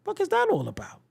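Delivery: high, baffled outburst; mock bewildered. Comedian mimicking a shopper baffled by the produce misters. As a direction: throw it up high, lightly baffled. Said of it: "Like, yo".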